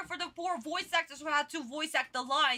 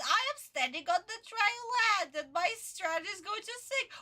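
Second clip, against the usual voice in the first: mocking the dub line; 6 semitones higher than usual. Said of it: "he commented about".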